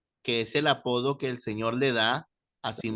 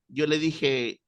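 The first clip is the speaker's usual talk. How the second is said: ordinary talk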